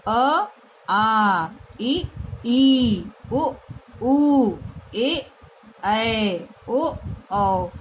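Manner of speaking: each vowel called out clearly, one by one; vowel recitation. Shown as a demonstration. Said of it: "इस प्रकार से हम इनका उच्चारण करते हैं". (स्वरों का स्पष्ट उच्चारण)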